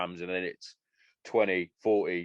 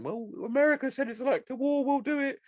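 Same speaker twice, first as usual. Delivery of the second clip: high, far-off mocking voice of the British government; sneering high impression of a government parroting its ally. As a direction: lampoon the government echoing America, pitched way up. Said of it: "Then you got fucking us going".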